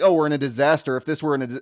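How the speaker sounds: usual speaking voice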